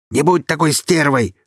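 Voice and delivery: high, loud angry shout. A narrator's reading. Kirill shouting at Alina as she walks away. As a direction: yell it at her furiously. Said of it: "крикнул парень".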